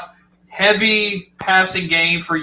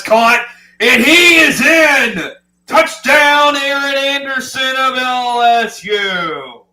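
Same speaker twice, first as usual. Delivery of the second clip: big excited touchdown shout, higher and louder; touchdown call; 7 semitones higher, 5 dB louder, slower than usual. Real, not acted.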